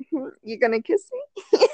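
coyly fishing for a kiss, amused; playful come-on with a laugh; flirty. Retold or imitated. Acts out flirty look at date.

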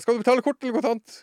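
high, loud, urgent mock yell; frantic shouted question. Desperate taxi driver asking how passenger pays, shouted. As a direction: fire off the question in acted panic.

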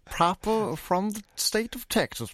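exaggerated Texas accent. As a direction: show off a proper Texas accent, proud. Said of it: "Your Texas accent is pretty bizarre".

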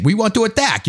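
lightly put-on fake Chinese accent, sliding into Italian; mock Chinese accent. Podcast host voicing China's leaders, botched mock Chinese accent. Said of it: "kind of an Italian accent for a Chinese man".

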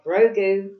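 teasing name call. Retold or imitated. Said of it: "he goes like".

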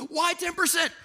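high-pitched mock outrage; hammed-up falsetto protest; protesting objector. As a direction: squeal the question like an indignant listener. Acts out the protesting listener.

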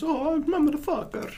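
very high, soft cartoon squeak; mock a game character in a tiny falsetto; Mario parody. Co-host spoofing Mario's sound effects, squeaky falsetto parody. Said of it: "He goes".

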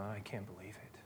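hushed, breathy mutter of disbelief; a Pharisee whispering in shock; whispered disbelief. Retold or imitated. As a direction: whisper it to yourself, scandalized. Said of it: "and he's going".

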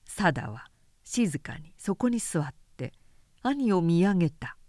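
plain reading voice

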